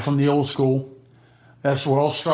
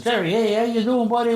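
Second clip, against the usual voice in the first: higher, overly friendly greeting; eager old acquaintance; 9 semitones higher than usual. Retold or imitated. Guy greeting Jerry in front of his girl, high and chummy. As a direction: fake buddy-buddy hello. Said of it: "He says".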